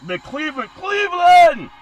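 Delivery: "Cleveland!" yelled high and loud like an announcer; shouted team name. Pretend. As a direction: boom out "Cleveland!". Announcing teams, sudden shout.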